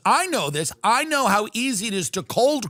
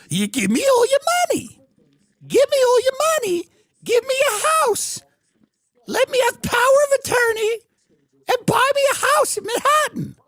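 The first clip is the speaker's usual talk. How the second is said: put-on much higher, louder pleading man-child; creepy childlike Epstein; 4 dB louder than usual. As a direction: squeak high to keep him on the line. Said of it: "childlike voice".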